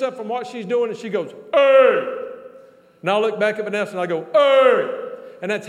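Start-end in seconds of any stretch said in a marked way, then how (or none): 1.5-2.2 loud, high imitated holler; hollered greeting. Preacher imitates Vanessa greeting him across the room, hollering. 4.3-5.1 loud, high bellow back; hollered reply. Retold or imitated. Preacher hollers back his greeting to Vanessa, loud call.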